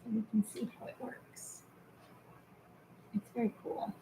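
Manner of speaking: whispered aside, breathy and hushed; whispering. Real, not acted. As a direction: drop to a whisper while searching. Quiet aside while searching video.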